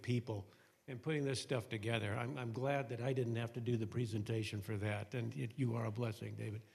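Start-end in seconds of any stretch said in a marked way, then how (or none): none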